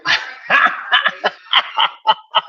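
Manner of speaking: long wheezing laugh; helpless, drawn-out laughing fit; real laughter. Real, not acted. Amused by viewer's KFC comment.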